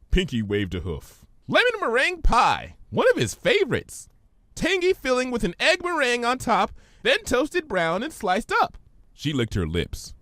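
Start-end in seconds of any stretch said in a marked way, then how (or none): 1.5-8.8 much higher, bubbly pony; Pinky's voice. Acted. Pinky puzzled by Twilight's big word, high voice.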